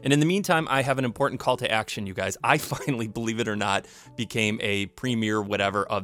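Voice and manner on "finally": ordinary delivery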